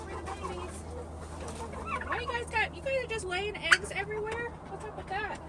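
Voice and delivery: high soft pet talk; baby talk. Acted. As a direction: coo softly at the hens.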